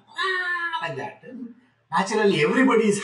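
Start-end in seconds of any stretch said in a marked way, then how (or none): 0.1-0.9 mock baby wail; imitated newborn bawl. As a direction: mimic a baby's cry on one long note. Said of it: "the child starts bawling".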